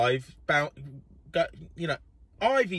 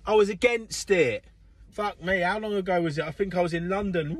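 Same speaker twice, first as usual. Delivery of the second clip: normal talking voice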